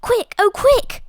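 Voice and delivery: high, urgent plea to hurry. A narrator's reading. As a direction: hurry him along, breathless urgency. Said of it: "cried Daisy".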